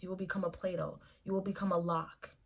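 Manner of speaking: ordinary delivery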